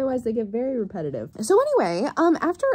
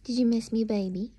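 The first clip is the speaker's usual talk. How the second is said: tender pet talk; 3 dB quieter than usual. Real, not acted. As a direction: coo it to the cat. Greeting the cat at home.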